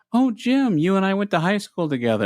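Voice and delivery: high, quick burst of fake familiarity; feigned delighted recognition. Prankster greeting a stranger as an old high school friend, feigned delight. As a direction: greet a long-lost pal, hammed up.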